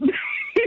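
high breathy laugh. Real, not acted. Caller laughs at own joke.

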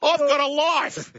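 high, drawn-out retort; slow, high-pitched mock outrage; indignant protest. Indignant retort to teasing accusation.

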